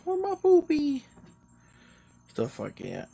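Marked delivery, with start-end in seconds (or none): 0.0-1.1 put-on high, loud baby talk to a pet; doggy baby talk. Shown as a demonstration. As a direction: coo at the dog like a baby. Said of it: "just being like".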